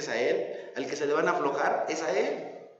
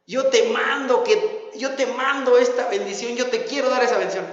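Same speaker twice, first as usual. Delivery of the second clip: insistent God; 6 semitones higher, 4 dB louder than usual. Retold or imitated. God offers the blessing.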